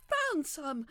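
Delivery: loud, high burst of hope; exclaiming. Acted. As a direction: call out the find with sudden hope. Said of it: "I exclaimed".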